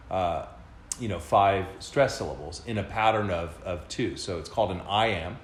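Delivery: ordinary delivery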